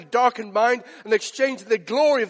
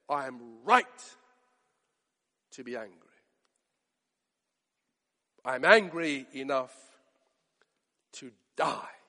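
bitter complaint; 8 semitones lower, 6 dB quieter than usual. Acted. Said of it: "he said".

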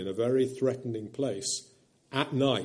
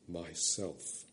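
a barely audible, much softer word; soft whisper; 6 semitones lower, 12 dB quieter than usual. A narrator's reading. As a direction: breathe it so softly it's hardly heard. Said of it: "whispered so softly you could hardly hear it".

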